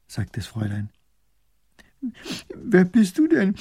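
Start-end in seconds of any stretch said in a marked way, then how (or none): 2.5-3.6 high, loud tearful wail; sobbing. A narrator's reading. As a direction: sob the question out. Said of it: "schluchzt die Fischerwitwe". (schluchzend, mit hoher Stimme)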